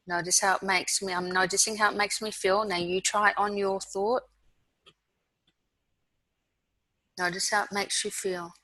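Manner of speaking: guided mindfulness. Acted.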